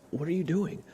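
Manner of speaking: ordinary delivery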